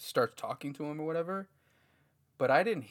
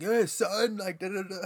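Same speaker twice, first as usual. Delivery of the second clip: much higher, louder cocky bro voice; swaggering comedian voice; 7 semitones higher, 5 dB louder than usual. Retold or imitated. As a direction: hype it up like a cocky comic.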